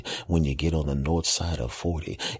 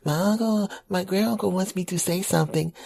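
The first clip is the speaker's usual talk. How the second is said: put-on very high child's voice; small boy relaying a message; little kid voice; 12 semitones higher than usual. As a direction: nephew to the operator. Nephew on the phone.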